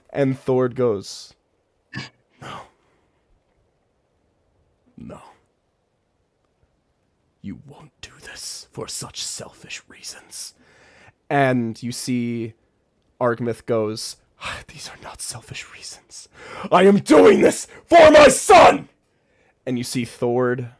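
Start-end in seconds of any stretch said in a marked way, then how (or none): 2.4-10.5 hushed, breathy whisper; whispered refusal. Acted. Thord rejecting Argmith's reasons in a whisper. 14.4-16.3 soft whispered denial; whispered reply. Acted. Argmith quietly answering Thord in a whisper. 16.7-18.9 high, loud outburst; anguished shout. Acted. Argmith shouting at Thord that it is for his son.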